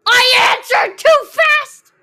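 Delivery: loud slow frustrated yell; frustrated shout. Real, not acted. Frustrated outburst at game.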